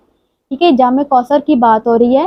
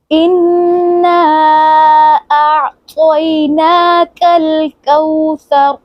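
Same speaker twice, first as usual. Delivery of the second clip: tajweed recitation; 3 semitones higher, much slower than usual. Shown as a demonstration. Teacher leads practice recitation, slow and chanted. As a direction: intone each syllable long and melodic. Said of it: "practice करते हैं".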